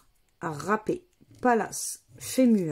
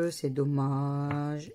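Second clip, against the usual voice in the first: slow, lower, softer echo of dommage; a low, soft drawn-out sigh of resignation; resigned; 7 semitones lower, 6 dB quieter, much slower than usual. Real, not acted. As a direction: let it sink quietly, resigned. Repeats the regret, low and soft.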